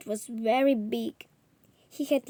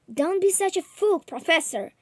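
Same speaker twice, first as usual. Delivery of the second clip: higher and louder, an urgent protest; cried out; 7 semitones higher, 6 dB louder than usual. A narrator's reading. Journalist crying out at the charging professor, loud protest. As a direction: cry out to stop him. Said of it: "I cried".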